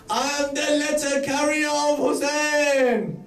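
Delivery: high, shouted announcement; proclaiming. Acted. Messenger answers Habib at the door, proclaiming.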